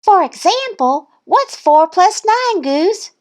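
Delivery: high, showy little-duck voice; Thistle's quizzing voice. A narrator's reading. Thistle quizzing Goose, high showy voice. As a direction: quiz in a high, challenging voice. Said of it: "she asked".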